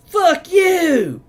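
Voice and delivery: loud, high holler down the street; full-throated screamed insult, drawn out. Retold or imitated. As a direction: bellow it out, stretched and high-pitched. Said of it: "I hear".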